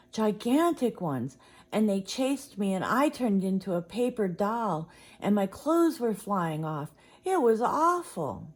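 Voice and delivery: high, loud, breathless rush of fear; a scared boy blurting out his bad dream; frantic retelling. Brother telling Mama his nightmare in frightened, loud excitement.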